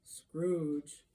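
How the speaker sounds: ordinary delivery, nothing marked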